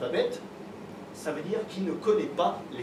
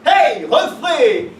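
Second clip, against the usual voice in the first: high, slow, shouted slang; slang teen voice; 7 semitones higher, 9 dB louder, much slower than usual. Retold or imitated. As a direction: call out like a cocky teenager. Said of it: "il m'a sorti un truc du genre".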